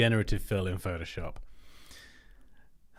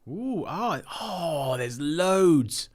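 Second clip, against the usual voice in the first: higher, thrilled ooh over the find; excited ooh; 6 semitones higher, much slower than usual. Real, not acted. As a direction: gush at the discovery. Finds the font family.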